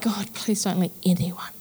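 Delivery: whispered, pleading under her breath; mock whispered prayer. Begging God under her breath that nobody visits. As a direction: hush it like a secret prayer.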